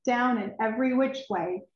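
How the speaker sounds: plain reading voice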